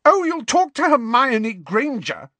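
put-on high, shrill, tearful girl; a shrill jealous jab. A narrator's reading. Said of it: "she said shrilly".

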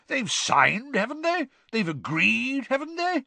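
high, swooping rhetorical questions; breezily dismissive. A narrator's reading. Bagman brushing off Crouch's secrecy, breezy. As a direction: override the objection cheerfully. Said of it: "waving the word away".

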